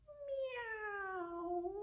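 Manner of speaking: high, soft kitty meow; cat meow. Acted. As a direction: mew like a small cat. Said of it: "and she says".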